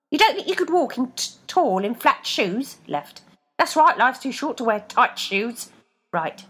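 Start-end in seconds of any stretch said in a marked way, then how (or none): 0.1-2.8 foot voice. A narrator's reading. Left coaxing Rebecca, higher voice. Left's voice. 3.6-5.7 higher, louder wry advice; foot voice. A narrator's reading. Right advising Rebecca, higher voice.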